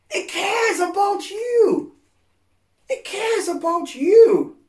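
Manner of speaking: tearful preaching shout. Real, not acted. Preacher pleading to the hurting that He cares, tearful shout. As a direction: cry it out from the heart, slowly.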